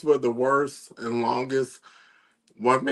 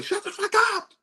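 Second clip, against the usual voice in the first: high shrieked mock scream; faster than usual. Fed-up listener shrieking at a car stereo, much higher. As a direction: screech it at the speakers. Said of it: "I was like".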